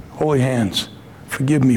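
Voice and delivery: usual speaking voice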